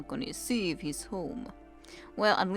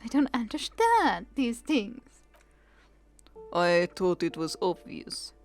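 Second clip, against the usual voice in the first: other voice. Acted. Skill voice replies.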